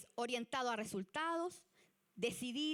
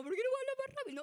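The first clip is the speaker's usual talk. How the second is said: much higher, fast, exasperated; impatient plea; 11 semitones higher, 3 dB louder, much faster than usual. Retold or imitated. Teacher imitating her own impatience, fast and high. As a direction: blurt it out, fed up with waiting. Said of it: "Y era como".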